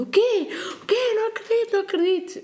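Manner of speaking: high, imitated disbelief; retold shock. Friend told news in the car, mimicked high shock.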